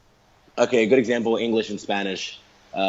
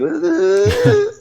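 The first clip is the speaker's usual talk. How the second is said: high, loud mimicked speech melody; wordless high Portuguese-style wail, held out; mock intonation noise, pitched far up; imitated Portuguese drone; 7 dB louder than usual. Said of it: "they always do this thing like".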